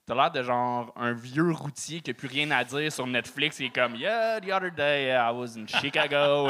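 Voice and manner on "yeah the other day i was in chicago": slow put-on English accent, jaded comic; mock English-accented stand-up voice. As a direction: drawl it in English like a tired road comic. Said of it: "c'est mon meilleur accent anglais".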